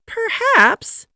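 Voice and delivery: very high, lilting "perhaps"; musing wife. A narrator's reading. First Lady musing over her stuck husband. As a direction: drift off thoughtfully, high.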